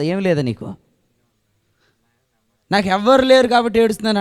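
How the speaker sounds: a hammed-up cry of nobody caring for me; mock self-pitying whine. Preacher mimicking a self-pitying believer, mock whining. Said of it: "అంటావు నువ్వు".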